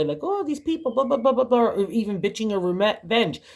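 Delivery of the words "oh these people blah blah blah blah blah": a high, whiny mock complaint about people; mock moaning. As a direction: whine it like someone wallowing in old hurt. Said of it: "in a moany, groany kind of way".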